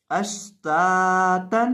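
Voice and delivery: अश्तातन read very slowly with each syllable held; drawn-out reading. Shown as a demonstration. Teacher showing students the whole word read slowly. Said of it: "ता दो ज़बर तन".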